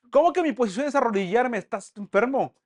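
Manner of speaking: loud, high-pitched acted outrage; indignant protest. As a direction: argue back as the one told to kneel. Discusión actuada de Jacob con el ángel. (protesta indignada actuada)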